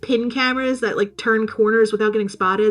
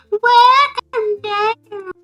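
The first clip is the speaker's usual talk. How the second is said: very slow, high, drawn-out greeting; an overplayed ominous invitation; mock sinister welcome; 12 semitones higher, 4 dB louder, much slower than usual. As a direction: stretch it out like a creepy cult host. Acted senator's creepy line.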